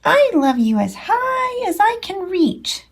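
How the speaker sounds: high little bunny voice; eager tiny hare. Acted. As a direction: reach up with your voice. Little Nut Brown Hare speaks.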